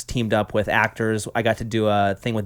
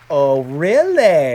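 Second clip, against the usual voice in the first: mock sassy quote; 2 semitones higher, 4 dB louder, much slower than usual. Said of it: "you are more willing to say".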